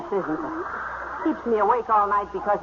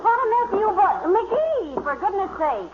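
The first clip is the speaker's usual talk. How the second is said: surprised exclaim; 8 semitones higher than usual. Acted. Molly finds McGee dragged downstairs.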